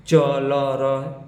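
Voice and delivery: each letter drawn out slowly and separately; sounding out letters. Shown as a demonstration. Teacher slowly sounding out each letter for students. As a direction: spell the letters out one by one for the class. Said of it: "teacher slowly sounding out letters".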